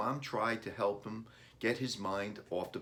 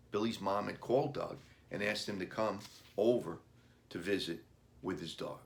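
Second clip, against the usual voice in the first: usual speaking voice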